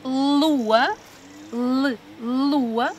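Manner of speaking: "lua" with a held l, and "l" sounded alone; stretched initial sound. Shown as a demonstration. Teacher showing children the first sound, "lua" with long l. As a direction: linger on the l of "lua", isolate it between. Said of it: "começa pelo mesmo som".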